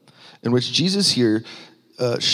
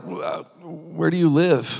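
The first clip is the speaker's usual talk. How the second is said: mock-flustered, higher and slow; caught off guard; 5 semitones higher, slower than usual. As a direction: stall on an "uh", then blurt a hesitant question. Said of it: "they're kinda caught off guard by this question".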